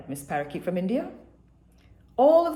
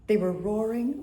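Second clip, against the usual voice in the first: ordinary delivery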